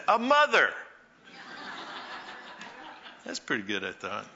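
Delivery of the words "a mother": put-on a much higher, louder child's blurt; a hint of an excited kid answering the teacher; eager little boy. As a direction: pipe up like a proud second grader. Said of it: "A little boy shot up his hand and said".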